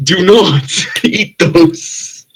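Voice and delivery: high, slow warning broken by laughter; real laughter in a slow, high-pitched warning; laughing warning. Real, not acted. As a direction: laugh through a drawn-out plea. Laughing warning over cursed pastries.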